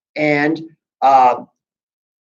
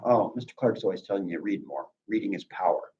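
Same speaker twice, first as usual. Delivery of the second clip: much softer, low, quick self-mocking aside; quiet aside; 6 semitones lower, 11 dB quieter, faster than usual. Real, not acted. Teacher slips students a soft self-mocking aside about reading. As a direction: drop it under your breath.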